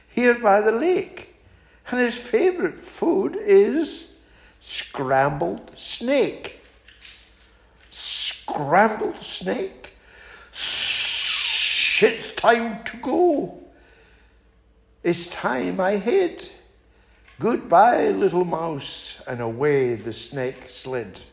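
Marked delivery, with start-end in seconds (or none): 0.1-6.6 high, polite little mouse turning down an invitation; small and prim, declining sweetly; a high, prim mouse fibbing to the owl. Acted. Mouse answers. 7.9-19.2 high, slow, with a nervous laugh; a high snake fleeing with a shaky laugh. Acted. Snake panics, flees.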